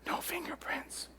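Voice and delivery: soft, slow breathy threat; menacing whisper. Retold or imitated. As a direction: hiss it close to his ear. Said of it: "and I whisper".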